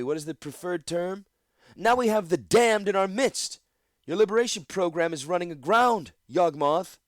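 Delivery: loud, high, scornful oratory; imperious councilwoman. Acted. Eldest Jameth harangues the council and Yawgmoth. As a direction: berate the council haughtily.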